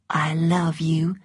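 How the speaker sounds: soft slow whisper; hoped-for whisper. A narrator's reading. Scarlett imagining Ashley's words, soft slow whisper. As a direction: breathe the words she longs for.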